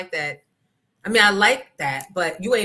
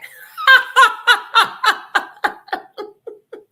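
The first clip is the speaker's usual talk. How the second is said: big loud cackle; loud cackling laugh; loud high laugh; 10 dB louder than usual. Real, not acted. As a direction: throw back a loud laugh. Laughing after flying too close joke.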